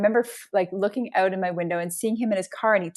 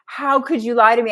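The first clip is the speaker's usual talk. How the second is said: louder, higher mock outcry; retold distraught scream; 4 semitones higher, 7 dB louder than usual. Child confronting parent over a lie, retold mild scream. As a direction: imitate a betrayed child crying out, without real tears. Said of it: "crying and screaming, like".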